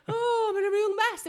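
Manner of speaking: loud, high hammed-up wail; mock distress. Messy clubber latching on to a helper, mock wailing. Voicing lost souls in clubs.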